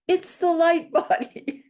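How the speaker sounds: very high, slow, laughing exclamation; astonished eureka. Retold or imitated. Author reliving her three a.m. revelation, high and laughing. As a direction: gasp it out, delighted and stunned. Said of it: "I was walking around for days going".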